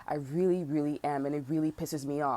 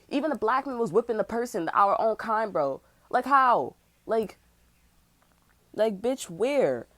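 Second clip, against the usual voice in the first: outraged rant; 4 semitones higher, 6 dB louder than usual. Real, not acted. Outraged rant about slavery.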